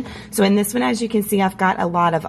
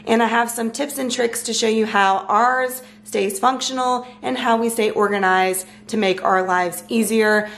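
normal talking voice

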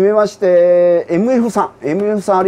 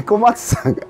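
laughing; 9 semitones higher than usual. Real, not acted. Laughs at friend's name.

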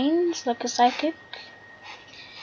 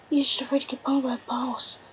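ordinary talk

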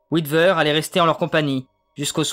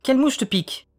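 higher, sharp exclaimed question; exclaiming; 9 semitones higher than usual. A narrator's reading. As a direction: she blocks his way and bursts out. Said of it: "s'exclama-t-elle".

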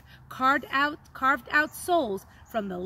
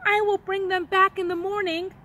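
calling out; 6 semitones higher, 4 dB louder than usual. A narrator's reading. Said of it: "he called as he left".

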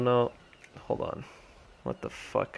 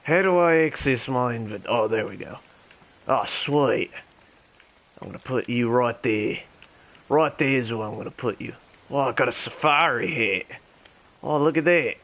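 terrible mock Australian accent; Ringo the Dingo. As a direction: chat along in a badly faked Australian accent. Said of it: "my terrible Australian accent".

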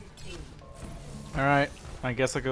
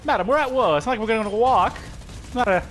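mock British accent; 7 semitones higher than usual. Mock gentleman voice again.

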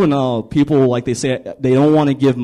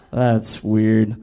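ordinary talk, nothing marked

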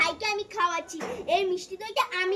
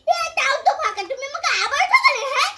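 louder, higher whine, half laughing; mock whining cry; 4 semitones higher, 7 dB louder than usual. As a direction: complain like a cheated child, laughing through it. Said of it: "আরে কান্না করিস না". (হাসি মিশিয়ে নকল কান্না)

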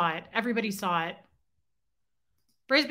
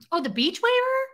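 surprised; 13 semitones higher, 5 dB louder than usual. Real, not acted. Surprised at a comment.

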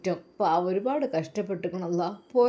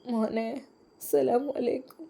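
acted tearful greeting, higher and drawn out; tearful mother; 5 semitones higher, 3 dB quieter than usual. Mother answers her son's early phone call, acted tearfully. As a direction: play a mother greeting her son through mild tears. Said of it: "എൻ്റെ ഉമ്മ എന്തിനാ കരയുന്നത്".

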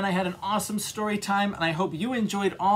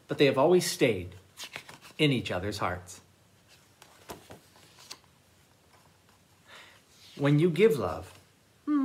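put-on lively read-aloud for children; storytime reading. A narrator's reading. Librarian reads picture books aloud.

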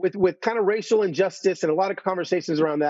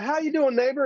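Southern drawl greeting; 7 semitones higher than usual. Retold or imitated. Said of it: "the warmest hello and his Southern drawl".

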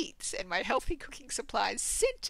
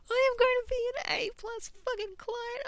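excited; 13 semitones higher, 5 dB louder than usual. Real, not acted. Excited vow to be top client.

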